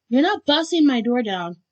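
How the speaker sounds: loud, high, forceful refusal; indignant objection. A narrator's reading. Listener forbidding them to break in, indignant.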